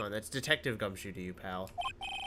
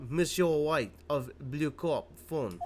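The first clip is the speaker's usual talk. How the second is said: slow put-on French accent; 2 semitones higher, slower than usual. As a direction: draw out the name in a French accent. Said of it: "I get to do a French accent".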